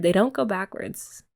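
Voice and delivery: ordinary delivery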